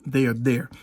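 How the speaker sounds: ordinary talk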